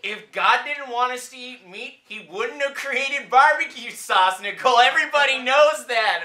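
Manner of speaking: loud, high, smug jab. Acted. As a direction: rub it in loudly. Couple's quarrel, mocking reply.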